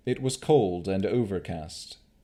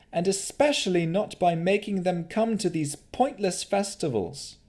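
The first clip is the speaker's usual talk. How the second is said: high, steady defiance; deputy answering the Baron; defiant Navara; 11 semitones higher than usual. Acted. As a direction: stand firm against his lies. Navara defends herself.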